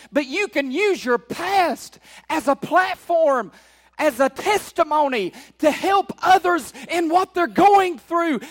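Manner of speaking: emphatic preacher, pitch lifted; impassioned preaching. Real, not acted. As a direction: urge the congregation onward with mounting force. Preaches with rising force.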